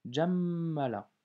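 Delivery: jammala drawn out slowly, doubled m; slow careful reading. Shown as a demonstration. Teacher repeating the word slowly for the student. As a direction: spell jammala out slowly for the learner. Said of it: "je répète".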